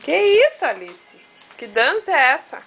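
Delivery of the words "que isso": high, louder surprised burst; Que isso, said higher and louder in delight; surprised exclaim. Real, not acted. Caught off guard by the baby's dance, surprised.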